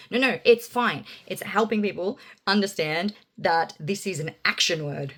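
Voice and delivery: ordinary delivery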